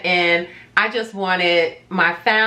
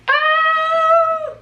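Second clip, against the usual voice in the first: mock surprise; 3 dB louder than usual. Teasing viewers, mock surprise.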